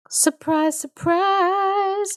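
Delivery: high, drawn-out sing-song surprise; mock surprise. Teller revealing an obvious plot twist, mock surprise.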